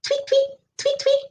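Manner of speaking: tiny, very high birdie tweets; high-pitched chirping. Shown as a demonstration. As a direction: tweet like a little bird. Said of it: "We might hear them say".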